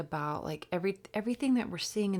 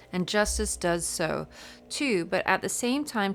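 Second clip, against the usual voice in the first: reading aloud; 2 semitones higher, 5 dB louder than usual. Real, not acted. Tarot reader reading a book passage to viewers, emphatic read-aloud. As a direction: read it out with a lively lilt.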